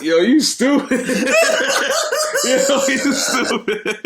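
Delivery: laughing hard. Real, not acted. Laughing at friend's joke.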